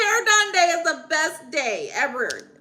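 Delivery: high, loud sing-song gushing; excited gushing. Real, not acted. Streamer cheering a viewer's hair done day, gushing. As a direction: draw it out with big delight.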